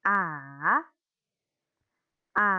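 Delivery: A dipping down then rising; dipping third tone. Shown as a demonstration. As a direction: swing A down and back up. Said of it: "Turun lalu naik".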